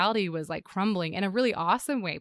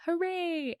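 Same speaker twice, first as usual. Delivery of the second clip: high, bright mock cheer; 11 semitones higher than usual. Mother cheering to her son in the car, high hooray. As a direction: sing out a happy hooray. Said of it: "I yelled out".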